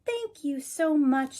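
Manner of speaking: high, slow thankful bird; grateful bird voice. A narrator's reading. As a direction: thank him as the little bird. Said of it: "says Bird".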